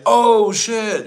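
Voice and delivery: high mock exclamation; mock amazed reaction. Streamer acts out crowd's reaction to Pei Pei, mock amazed. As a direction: react in pretend astonishment. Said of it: "And then it's like".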